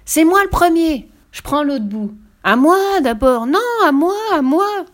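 high, loud clamoring; squabbling children. A narrator's reading. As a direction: fight to go first. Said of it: "avec des cris de joie". (les enfants se disputent la première place en criant)

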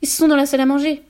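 high, loud alarm; mildly choked, urgent cry; strangled voice. A narrator's reading. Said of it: "d'une voix étranglée".

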